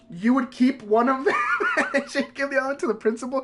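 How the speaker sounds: real laughter breaking through the words; laughing. Real, not acted. Storyteller explaining the school write-up slips, laughing.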